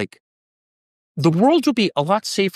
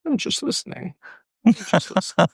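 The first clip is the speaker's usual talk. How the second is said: a light German-accented impression; Henry Kissinger; 5 semitones higher than usual. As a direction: mimic an old German statesman's accent. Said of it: "if I spoke like Henry Kissinger".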